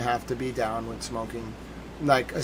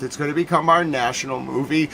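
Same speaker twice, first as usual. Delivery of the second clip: ordinary talk, nothing marked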